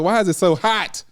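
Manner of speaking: high-pitched mock dismay at the heat; mock complaint. Co-host retelling walking in overdressed, mock complaint. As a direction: retell it as an exaggerated whine of complaint.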